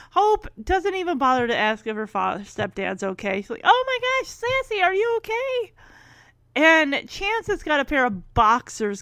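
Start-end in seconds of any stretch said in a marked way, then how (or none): 3.6-5.7 high-pitched mock alarm; worried cry. Girl checking on her fallen cat, mock worried. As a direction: fuss over the pet in a high voice.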